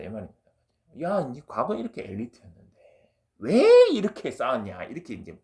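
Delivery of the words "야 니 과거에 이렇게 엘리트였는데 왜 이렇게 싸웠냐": loud, high, drawn-out mock protest; raised-voice imitation of bitter reproach; resentful reproach. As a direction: act out a soldier's exasperated blame. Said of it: "원망을 하고 있어요".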